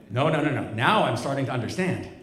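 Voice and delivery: ordinary talk, nothing marked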